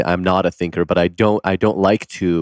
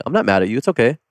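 put-on innocent kid voice; 6 semitones higher, faster than usual. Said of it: "kids are so playful and innocent".

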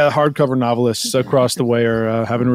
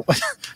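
high laugh; 6 dB quieter than usual. Real, not acted. Real laugh.

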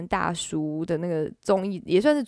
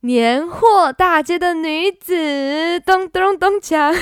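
excited announcement; 8 semitones higher, 6 dB louder, slower than usual. Real, not acted. Announcing the first song title.

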